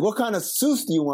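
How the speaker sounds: Baltimore "sauce" said like "Seuss"; Baltimore accent. Retold or imitated. Wendy's girl taking a nuggets order, imitated Baltimore accent. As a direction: imitate a Maryland counter girl's Baltimore accent. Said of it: "and the girl tell, asked me".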